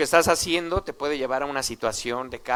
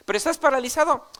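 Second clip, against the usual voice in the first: mock retort; 10 semitones higher, 5 dB louder, faster than usual. Jesus imagined countering the reply.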